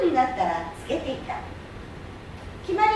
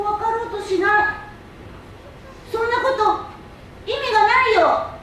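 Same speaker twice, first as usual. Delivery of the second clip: scolding; 6 semitones higher, 3 dB louder than usual. Acted. Prince scolds the businessman.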